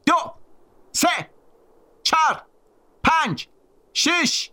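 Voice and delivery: high-pitched shouted threat; threatening countdown. A narrator's reading. Bully threatens, starts counting.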